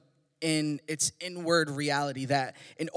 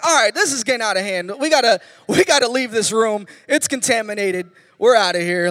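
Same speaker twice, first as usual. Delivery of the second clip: mock alarm; 3 semitones higher, 8 dB louder than usual. Voicing himself fleeing the smell.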